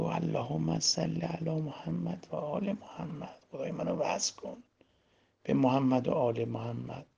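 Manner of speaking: slow, hushed salawat; soft, calm prayer. Shown as a demonstration. Preacher shows listeners how to connect, praying softly and slowly. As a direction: say it very softly, lingering on each phrase. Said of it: "اتفاقاً آروم بگو".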